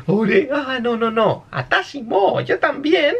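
put-on climbing into a high girly voice; cambio a voz femenina. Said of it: "Y ya cambia al Atashi una vez más". (corrigiéndose, cada vez más agudo)

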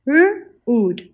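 R-ood split into onset and long vowel; segmented. Shown as a demonstration. Teacher sounding out R-ood for the class. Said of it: "Say it with me".

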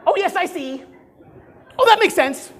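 high, loud, gushing agreement; salesperson listening. Shown as a demonstration. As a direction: nod along like an eager bobblehead. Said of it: "Now I'm gonna show you how a salesperson listens".

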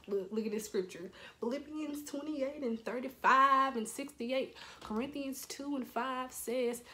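hammed-up Bible-quoting father; imitating churchy dad. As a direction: cite scripture like a preacher, drawn out. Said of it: "he went church on me".

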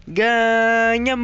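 long, loud, high victory yell; triumphant shout. Real, not acted. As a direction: stretch the word out in a winning shout. Celebrates winning the game.

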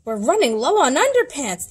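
a touch of mock panic; shocked caveman. A narrator's reading. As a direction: panic about the pants. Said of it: "the caveman quaked in shock".

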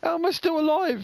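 loud high disbelieving cry; incredulous shout. Real, not acted. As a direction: yell it in amazed disbelief. Survived again, incredulous.

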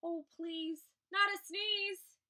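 pleading animal outcry; crying out in alarm. A narrator's reading. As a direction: cry out the plea, stretching it slowly. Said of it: "cried the bear".

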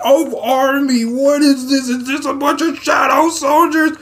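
high, sustained mock-panicked shouting; high-pitched hammed-up freak-out; alarmed disbelief. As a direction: cry out at the sight of an army. Alarmed reaction to a monster army.

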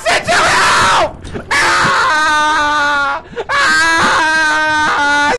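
being chased. Real, not acted. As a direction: scream in panic. Chased again, screaming.

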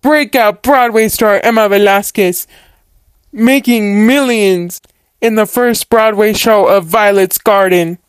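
news announcer. Acted. Reporter announcing the breakout Broadway star, in a broadcast voice. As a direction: present her like a TV entertainment reporter.

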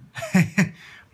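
real laugh. Real, not acted. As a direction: burst of laughter. Laughing at the helium voice.

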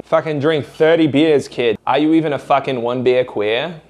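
rowdy Boston bro, mocking, accent laid on thick. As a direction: bark it like a Boston townie daring you to drink. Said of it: "instead of a fucking moron".

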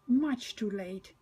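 usual speaking voice

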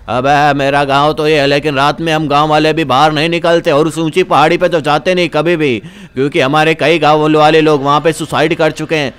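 put-on low old man cautioning visitors; low-pitched elder character; warning old villager. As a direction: play an old local warning of mortal danger.

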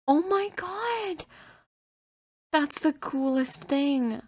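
high, loud, slow delight; excited squeal. Real, not acted. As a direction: squeal over the new pet. Obsessed over this pet now.

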